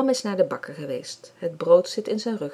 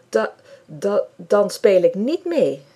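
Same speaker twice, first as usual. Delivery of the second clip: stammering; 2 semitones higher, 8 dB louder, slower than usual. A narrator's reading. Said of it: "zegt hij".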